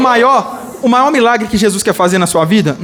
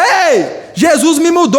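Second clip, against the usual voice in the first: high, drawn-out proclamation; shouted testimony; 6 semitones higher, slower than usual. Shown as a demonstration. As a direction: call it out higher, stretching the words. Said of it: "Espalha, grita".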